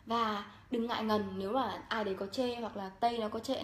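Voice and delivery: ordinary talk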